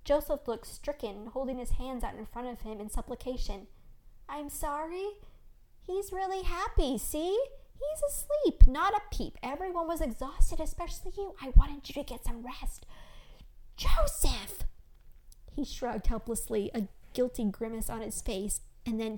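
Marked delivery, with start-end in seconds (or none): 4.3-12.9 higher-pitched, defensive husband excuses; sheepish, high-voiced reassurance; apologetic pleading. A narrator's reading. Husband makes excuses to alarmed wife. 13.8-14.6 loud, higher stage-whispered scolding; breathy, forceful scolding of a husband; exasperated rebuke. A narrator's reading. Alarmed wife rebukes husband.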